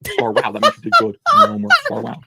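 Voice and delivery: very high, loud singsong teacher praise; a hammed-up, squeaky-high teacher voice; kindergarten teacher. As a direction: gush like a kindergarten teacher handing out gold stars. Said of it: "like my kindergarten teacher did".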